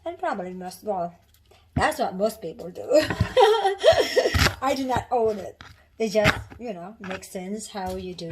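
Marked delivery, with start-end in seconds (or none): 2.9-4.6 loud, high-pitched burst of laughter; real laugh. Real, not acted. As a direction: laugh out loud at your own remark. Laughs at her own remark.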